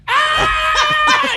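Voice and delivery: high shrieking yell of panic; panicked scream. Retold or imitated. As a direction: scream like you're terrified mid-fight. Panicked scream during car fight.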